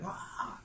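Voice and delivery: a whispered mock cry of pain; a child's whispered ouch after kicking an ankle; mock yelp. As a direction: yelp it without voice. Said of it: "he's like".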